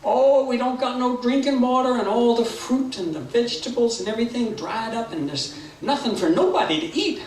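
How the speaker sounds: high, wailing animal lament; complaining critters. Acted. Critters tell Brer Rabbit about the drought, high plaintive voice. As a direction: lament it in a high, sing-song voice. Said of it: "And they said".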